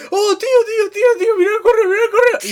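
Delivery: hammed-up crying plea to a friend; mock whiny plea. Friend whining at co-host mid-argument, mock crying plea. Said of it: "tú me dijiste".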